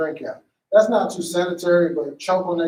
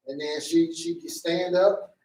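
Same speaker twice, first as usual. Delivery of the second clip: ordinary talk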